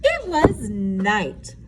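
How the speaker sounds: usual speaking voice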